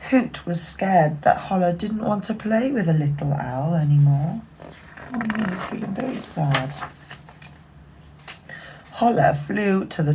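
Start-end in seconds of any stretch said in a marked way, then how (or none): none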